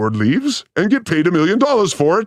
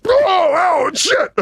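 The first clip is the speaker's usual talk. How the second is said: higher, hammed-up yelp of pain; mock pained yell; 11 semitones higher, much slower than usual. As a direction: cry out like you just got zapped. Acting out stage mishap cry.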